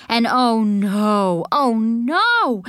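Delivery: drawn-out mock horror; alarmed. A narrator's reading. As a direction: cry out slowly in alarm. Child spots a witch, alarmed.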